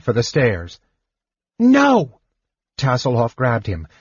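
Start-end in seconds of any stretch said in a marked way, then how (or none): 1.6-2.2 high-pitched cry of alarm; urgent cry. A narrator's reading. Kender grabbing fleeing companion, alarmed high cry. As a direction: shout to stop him, pitched way up. Tas stops Seston fleeing.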